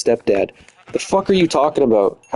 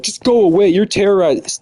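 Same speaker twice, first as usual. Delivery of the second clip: mocking whiny girl; 7 semitones higher than usual. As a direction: sneer her words back at her, pitched way up. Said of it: "And she's like".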